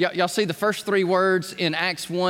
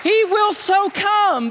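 higher, louder, slow proclamation; exultant proclamation; 12 semitones higher, 5 dB louder, slower than usual. Real, not acted. Preacher proclaiming to the congregation, higher, louder, slow. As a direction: declare it to the rafters.

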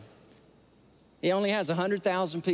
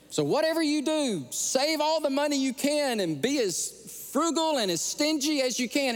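higher, lightly voiced grandmother giving firm advice; grandmother's warning; 10 semitones higher than usual. Grandmother warns grandson to save money, higher firm voice. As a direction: play the stern grandma urging thrift. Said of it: "she said".

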